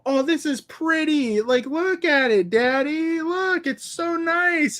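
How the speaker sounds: put-on high, slow, sing-song little girl; imitating the daughter admiring the card. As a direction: coo over the shiny card to Daddy. Six-year-old daughter voiced in story.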